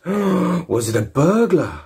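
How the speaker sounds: put-on high, slow, wide-eyed child's question; awed little kid voice; gasping kid. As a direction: gasp it out like an amazed kid. Kid four gasps and asks.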